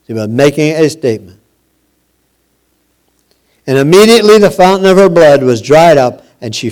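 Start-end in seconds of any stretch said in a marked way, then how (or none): 3.7-6.2 louder, quicker telling of the miracle; rising excitement. Acted. Preacher to his congregation, swelling with excitement. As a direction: build the wonder of the healing.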